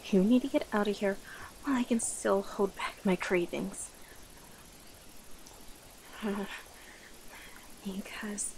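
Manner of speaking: breath-heavy warning, heavy sighs between phrases; starving predator. Acted. Warning the human to flee before cravings win, strained. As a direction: hold back the craving, sinking toward a whisper.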